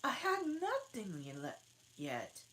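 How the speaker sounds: put-on high, put-out girl; offended. Alice offended at the Hare, high. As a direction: sound slighted. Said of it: "with an offended tone".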